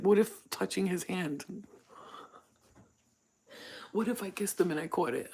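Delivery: scared. Retold or imitated. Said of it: "I was so scared".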